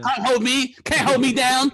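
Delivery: mock gangster bravado. Comic plays the hardened criminal, mock defiant. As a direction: belt it out like a pretend hard man.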